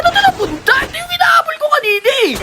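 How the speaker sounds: high, fast and incredulous; startled exclamation. Real, not acted. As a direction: blurt it out in high-pitched surprise. Surprised where the chased enemy went. (gulat na gulat, mataas at mabilis)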